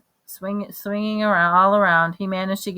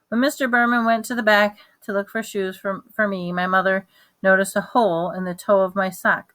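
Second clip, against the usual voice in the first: ordinary delivery, nothing marked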